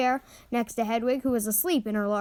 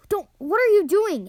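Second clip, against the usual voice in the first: louder, higher urgent hiss; alarmed hiss; 7 semitones higher, 5 dB louder than usual. A narrator's reading. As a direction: stop him, urgent and sharp. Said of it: "Harry hissed".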